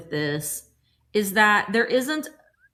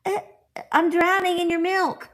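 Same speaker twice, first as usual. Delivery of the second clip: higher, whiny little cat complaint; 9 semitones higher than usual. Pretend. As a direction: voice the sad cat in the cup. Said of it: "that cat's like".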